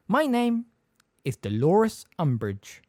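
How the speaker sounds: slow, high prim voice; sugary, slow self-introduction; Umbridge's voice. Acted.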